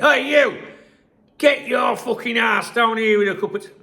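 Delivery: high, loud bellow up the stairs; shouted demand. Retold or imitated. Shouting upstairs at his wife for a cup of tea, loud mock bellow. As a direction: yell it up the stairwell, all bossy. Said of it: "I shouted upstairs".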